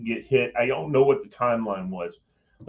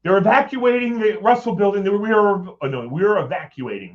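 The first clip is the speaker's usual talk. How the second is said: urgent alarm; 9 semitones higher, 5 dB louder than usual. Retold or imitated. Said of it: "the guy comes running in".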